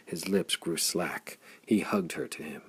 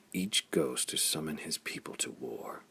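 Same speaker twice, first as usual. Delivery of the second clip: heavy; 6 semitones lower, 8 dB quieter than usual. A narrator's reading. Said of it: "Flayn said heavily".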